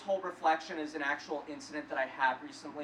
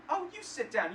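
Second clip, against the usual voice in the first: higher-pitched mock put-down; lightly acted, pitched-up scorn; scornful dismissal; 7 semitones higher than usual. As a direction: imitate a belligerent woman brushing him off. Drunk woman dismisses him.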